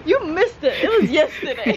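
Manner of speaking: mock bad news through giggles; laughing. Joking that the monthly screening was missed, laughing.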